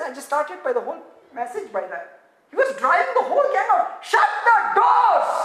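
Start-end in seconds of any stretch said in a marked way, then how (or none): 2.5-5.3 shouting. Acted. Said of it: "Sorry, I'm shouting".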